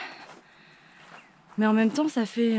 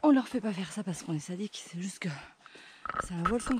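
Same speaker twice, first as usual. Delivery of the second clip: whispering; 3 semitones lower, 8 dB quieter than usual. Real, not acted. Whispered aside on the climb.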